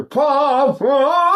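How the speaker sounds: high short pa breath stops. Shown as a demonstration. Teacher demonstrates breath stops up high. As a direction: pop the pa up high. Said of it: "if I do it up here".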